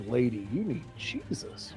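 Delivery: normal talking voice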